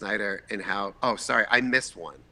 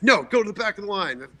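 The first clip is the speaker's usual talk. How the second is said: higher, louder mock bark; indignant refusal; 9 semitones higher, 5 dB louder, faster than usual. People in line refuse his plea to cut, indignant refusal. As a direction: imitate an annoyed crowd sending someone away.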